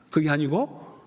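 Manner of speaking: ordinary delivery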